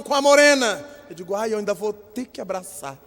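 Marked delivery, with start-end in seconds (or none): 1.3-3.0 softer, put-upon sigh of a line; reluctant grumble. Retold or imitated. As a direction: complain quietly to yourself. Dreading greeting the couple. (resmungo contrariado)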